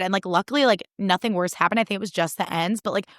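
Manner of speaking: ordinary talk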